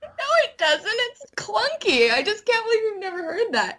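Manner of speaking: flustered protest. Real, not acted. Embarrassed protest at compliment.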